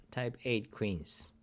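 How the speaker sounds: usual speaking voice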